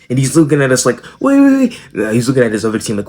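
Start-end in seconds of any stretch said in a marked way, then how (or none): 1.2-1.8 high, flustered wait wait wait; mock panicked kid; confused kid. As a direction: play the kid who has just caught on, pitched way up. Confused kid voiced in story.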